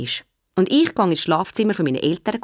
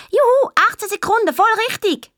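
very high, cheering schoolboy; jubilant shout; 3 dB louder than usual. Acted. Willi cheers his count to the class, jubilant call. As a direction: whoop it out in triumph. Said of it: "ruft der Willi".

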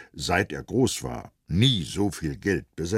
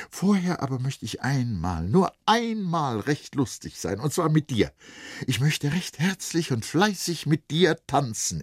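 hohe, helle Mädchenstimme, sehnsüchtig und fröhlich; junges Mädchen; 9 semitones higher than usual. Acted. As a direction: sprich als verliebtes Mädchen, das tanzen will. Vrenchen spricht, Erzähler liest. (höhere Mädchenstimme, verträumt)

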